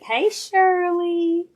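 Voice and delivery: slow, high, loud sing-song hello; cheery greeting. Real, not acted. Host greeting viewer Shirley in the live chat, slow, high and loud. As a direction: call out a delighted welcome.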